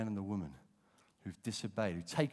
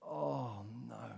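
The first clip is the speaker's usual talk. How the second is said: ordinary delivery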